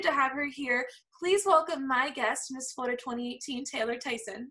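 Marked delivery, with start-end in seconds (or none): 1.2-4.5 announcer intro. Real, not acted. Host introducing guest on show.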